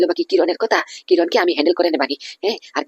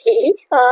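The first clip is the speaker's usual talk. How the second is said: high laugh; 11 semitones higher, 3 dB louder than usual. Acted. Henchman laughs at the call.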